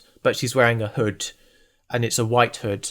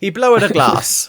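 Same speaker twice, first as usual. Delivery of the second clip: mock Italian accent; 8 semitones higher, 5 dB louder than usual. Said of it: "joke about the accents".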